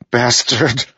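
high-pitched, angry spit of a word; spat curse. A narrator's reading. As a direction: spit it out at the enemy in anger. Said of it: "Adolin spat".

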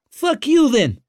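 put-on high, loud angry curse in a thick accent; spat in thick accent. A narrator's reading. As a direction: spit it out, piercing and foreign-sounding. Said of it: "he spat in a thick accent".

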